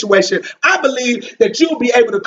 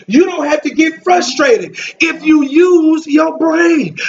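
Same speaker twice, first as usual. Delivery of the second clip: preaching at full force; 5 semitones higher than usual. Acted. Preacher to church, driving the refrain at full force. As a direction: hammer the refrain home to the congregation.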